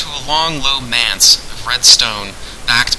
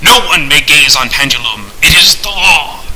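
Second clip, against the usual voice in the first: loud, solemn decree; imperious host; 3 semitones higher, 5 dB louder than usual. Acted. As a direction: lay down the law, slowing and rising. Unseen host lays down law.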